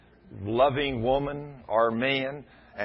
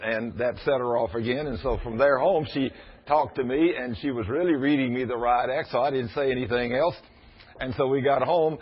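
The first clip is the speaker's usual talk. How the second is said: normal talking voice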